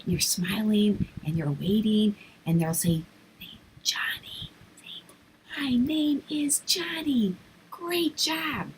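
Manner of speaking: put-on whispered, softly prompting the child. Shown as a demonstration. Teacher quietly coaching a shy child, whispering. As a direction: breathe it so only the child hears.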